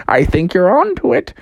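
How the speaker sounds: high, chuckling riddle master; talking house. Acted. House teases Froggy as he nears the answer, chuckling. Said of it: "the house was like".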